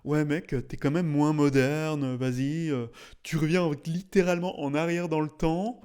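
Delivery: drawn-out mock complaint; imagined critic. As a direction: imitate a whining objector, stretching the words. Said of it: "certaines personnes vont me dire".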